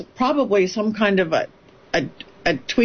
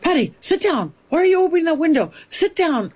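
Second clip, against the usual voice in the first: higher, urgent mock yell, fast in the middle; retold yell; 8 semitones higher than usual. A producer yelling at Patty at the open window, alarmed mock yell. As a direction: snap at her to sit down, alarmed. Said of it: "Zach starts yelling at me".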